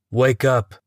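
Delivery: loud rough snarl of "Wake up". Acted. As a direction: bark him awake. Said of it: "a voice snarls".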